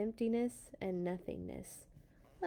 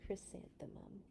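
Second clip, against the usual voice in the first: soft hush; 14 dB quieter than usual. A narrator's reading. Soft ending of story name.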